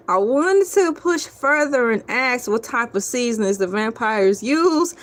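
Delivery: ordinary delivery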